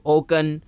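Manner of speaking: اوکن with K for the C; wrong K reading. Shown as a demonstration. Teacher asks why not read it so, K sound. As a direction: say اوکن as OH-kun. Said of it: "کیوں نہیں پڑھ رہے".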